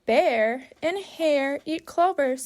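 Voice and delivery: normal talking voice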